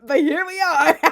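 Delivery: high, loud burst breaking into a cackle; loud laughing. Real, not acted. Vlogger laughing at posting her private thoughts online, loud laughing. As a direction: shout it through a laugh.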